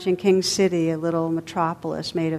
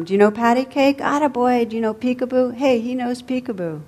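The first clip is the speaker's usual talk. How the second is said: shouting across a room; 6 semitones higher than usual. A narrator's reading. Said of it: "shouting from across the room".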